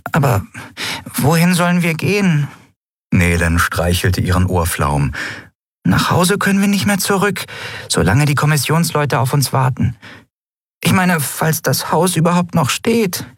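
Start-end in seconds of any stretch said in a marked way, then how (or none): 0.1-2.5 lightly voiced high character; high-pitched, a touch of worry; anxious small companion. A narrator's reading. Nelen voiced by narrator. 5.8-13.3 high-pitched character voice; high, fretting character; worried small companion. A narrator's reading. Nelen voiced by narrator.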